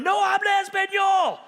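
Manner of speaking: high, loud shout in clumsy Spanish; hammed-up yelled Spanish; mock shout. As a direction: shout it back, playing the clueless foreigner. Feigned ignorance shouted back at cop.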